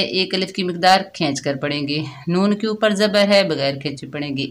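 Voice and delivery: ordinary delivery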